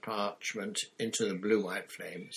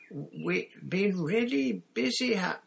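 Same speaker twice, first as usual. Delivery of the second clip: put-on high, slow, faltering girl; startled girl; 10 semitones higher, slower than usual. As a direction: trail off, startled mid-word. Hermione cut off, lost for words.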